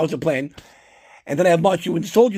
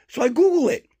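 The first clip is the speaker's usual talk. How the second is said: ordinary talk, nothing marked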